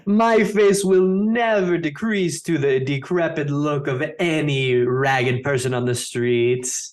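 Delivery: slow, haughty operatic recitative; grand opera declamation. Acted. Opera diva answering her admirer, grand declamation. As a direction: proclaim it grandly, drawing out the words.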